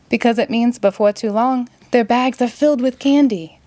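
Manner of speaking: ordinary delivery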